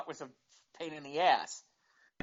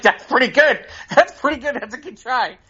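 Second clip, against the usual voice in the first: loud, high retold praise; amused approval; 7 semitones higher, 11 dB louder than usual. Father admiring his kid's prank behind mom's back, loudly delighted. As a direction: imitate a dad openly tickled by the prank.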